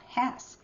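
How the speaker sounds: "Hes" pitched high as the stressed syllable; first-syllable stress. Shown as a demonstration. Teacher showing which syllable carries stress, high stressed "Hes". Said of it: "the stress is on the first syllable".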